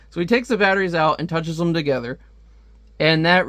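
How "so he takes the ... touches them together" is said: ordinary delivery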